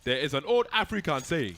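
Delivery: slow, high, loud catchphrase; mock sage pronouncement; TV show catchphrase. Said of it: "there was a guy who'd always be like".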